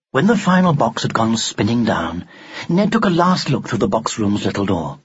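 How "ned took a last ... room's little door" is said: ordinary delivery, nothing marked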